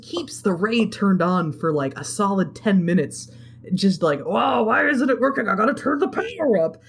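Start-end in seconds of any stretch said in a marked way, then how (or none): 4.2-6.8 high-pitched imitation of frantic screaming; mock panicked yell. Retelling the villain fumbling his ray, mock screaming. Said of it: "like, screaming while he does it".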